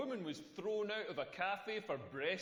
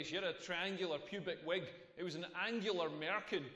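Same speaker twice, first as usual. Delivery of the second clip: ordinary delivery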